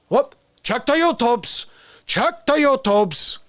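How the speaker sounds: slow, drawn-out Bernie; Bernie impression. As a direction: stretch it out like Bernie scolding. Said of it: "You wanna hear Bernie?".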